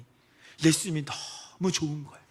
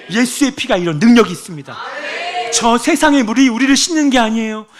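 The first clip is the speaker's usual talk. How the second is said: much louder and higher shouted sermon; fiery preaching; 11 semitones higher, 14 dB louder than usual. Acted. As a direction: proclaim it to the congregation with fire. Preaching at full force.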